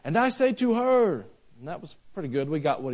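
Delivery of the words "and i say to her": pitched higher, mimicking a visiting preacher's broken English; lightly voiced imitation of the visitor; quoting Brother Gomez. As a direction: echo his earnest pulpit phrasing, pitched up. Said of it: "and he said".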